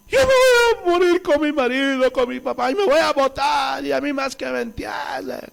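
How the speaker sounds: hammed-up, high funeral wail; crying and shouting. Mourners at a secular funeral, imitated screaming wail. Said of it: "la gente llorando, gritando".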